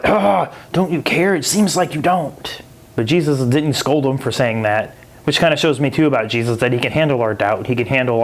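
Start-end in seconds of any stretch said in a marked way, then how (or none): 0.0-2.6 whiny complaint. Retold or imitated. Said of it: "have you kinda like".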